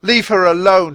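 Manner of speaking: put-on boy shouting at a wolf over his sister; angry shout. A narrator's reading. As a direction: fearless anger protecting his sister. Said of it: "he shouted".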